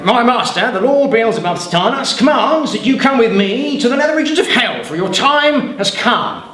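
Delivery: a hint of a pompous demon messenger; demon's summons. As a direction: announce his doom grandly. Middle demon delivers the summons.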